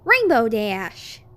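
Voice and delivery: loud, slow, stern call; scolding. Acted. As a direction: call her name sharply. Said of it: "scolded A J".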